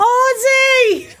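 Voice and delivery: high, loud shrieked call to her husband; Sharon Osbourne shout. Retold or imitated. Co-host yells for her husband, high shrieking call. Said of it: "Sharon Osbourne would have been a little bit better".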